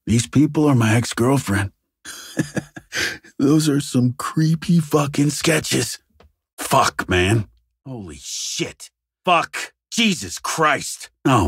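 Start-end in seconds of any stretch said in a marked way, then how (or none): 2.4-3.2 soft, breath-only laugh; a quiet huff of amusement; short chuckle. Acted. Investigator looking at creepy sketches, amused chuckle. 7.9-8.9 very soft, slow disbelief; hushed shock. Acted. Investigator takes in a horrible scene, hushed shock.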